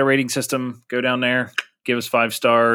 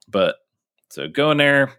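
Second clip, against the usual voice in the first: usual speaking voice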